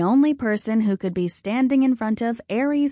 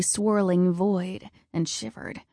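ordinary delivery, nothing marked

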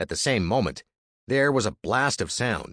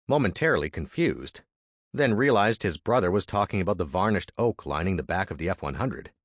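ordinary delivery

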